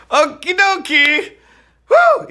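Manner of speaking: high, loud, slow chirp then whoop; bubbly. Acted. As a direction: burst into a playful whew. Bubbly transition.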